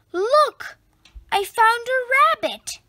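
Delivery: put-on high, loud boyish shout; excited cry. A narrator's reading. Boy finds the toy rabbit, excited shout. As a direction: cry out with delight at the find. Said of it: "He cries out".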